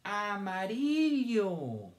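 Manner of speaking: AMARILLO stretched slowly, high and singsong; syllable by syllable. Shown as a demonstration. Teacher asking children to count syllables, drawn out. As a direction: draw out AMARILLO high, falling at the end. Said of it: "¿Cuántas sílabas tiene?".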